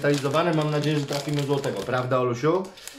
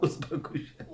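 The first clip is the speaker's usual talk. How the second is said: whispered hush; 10 dB quieter than usual. Real, not acted. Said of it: "calm down, whispered to child".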